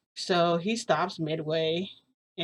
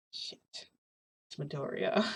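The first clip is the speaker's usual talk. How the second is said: hushed curse; 2 semitones lower, 9 dB quieter, slower than usual. Retold or imitated. Caught out as Midoriya knocks on the door, hushed curse. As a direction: curse under your breath so nobody outside hears.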